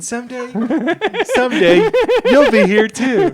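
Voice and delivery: high giggling ghostly parent; laughing through ghost voice. Retold or imitated. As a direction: laugh while playing the ghost. Voicing dead parent, laughing.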